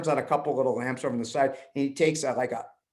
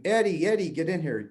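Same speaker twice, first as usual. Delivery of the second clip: mild mock scream; 5 semitones higher than usual. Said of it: "She's screaming".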